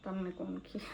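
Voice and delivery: teasing whisper under her breath; a playful whisper aimed at her husband. Real, not acted. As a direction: whisper it as a sly jab.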